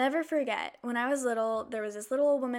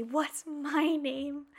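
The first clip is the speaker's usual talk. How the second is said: put-on slow, higher little-kid voice asking; child asking; 4 semitones higher, much slower than usual. Young girl asking the French teacher her name, slow. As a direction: ask it slowly like an eager little kid. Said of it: "and I say".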